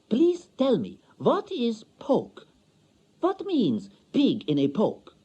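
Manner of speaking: high, slow, curious questions; wife begging for an answer; eager foreign wife. A narrator's reading. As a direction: ask like a delighted child.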